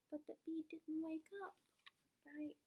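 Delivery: plain reading voice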